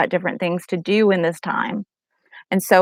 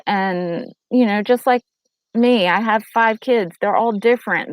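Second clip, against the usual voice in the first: usual speaking voice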